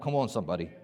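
usual speaking voice

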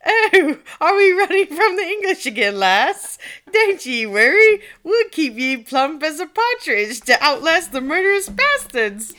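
loud, high, rousing mock-Scottish accent; put-on Scottish accent. Peasant DNA reassuring its owner from the meme, hammed-up Scottish accent. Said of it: "I have to do it with an accent".

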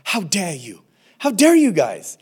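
hammed-up how dare you; Greta Thunberg impression. [name] scolds the church like Greta Thunberg, mock outrage. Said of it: "pulls a Greta Thunberg's".

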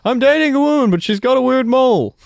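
high, loud mocking impression; mock Jerry voice. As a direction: ham up a whiny sitcom guy. Voicing Jerry's typical plot.

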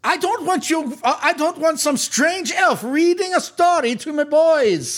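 slow, high, emphatic objection; indignant matron. Acted. Matron rejecting strange elf's story time, indignant. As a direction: draw out the disapproval in a high voice.

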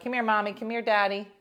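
a hint of a loud Italian mother; mildly voiced Italian mother calling the kids; Italian mom. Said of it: "that's what Italian".

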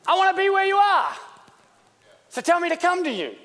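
high, impassioned call building to a shout. Acted. Peter pleading to join Jesus on the water, calling out.

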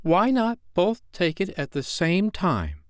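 put-on high, eager girl's voice; bright idea. A narrator's reading. Wendy suggesting a compromise, eagerly. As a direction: propose it as a splendid idea. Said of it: "Wendy had a splendid idea".

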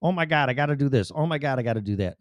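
fast, panicked "Oh my God, I gotta do this" rattled off; frantic rush. Retold or imitated. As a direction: rush the list like you're overwhelmed. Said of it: "it's like knockout punches".

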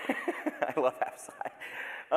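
giggling through the words; laughing fondly. Real, not acted. Pastor gushing over a baby photo, laughing. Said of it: "he's so cute".

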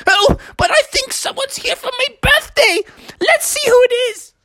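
put-on high, excited old frog character; Froggy's excited high voice; eager birthday frog. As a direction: hurry eagerly to greet a guest.

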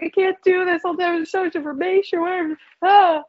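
high-pitched retold exam panic; mock spazzing. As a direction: act out a hysterical pre-exam meltdown. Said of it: "It's classic spazzing".

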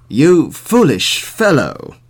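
slow, loud rebuke; scolding sheriff. A narrator's reading. Sheriff scolding the old man, slow and loud. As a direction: weigh each word of the scolding.

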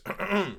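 loud, high put-on throat clear; imitated attention-seeking "ahem"; pointed throat clearing. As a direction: clear your throat to get noticed. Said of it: "And then Donkey goes".

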